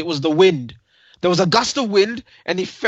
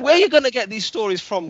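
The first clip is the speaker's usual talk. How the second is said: ordinary delivery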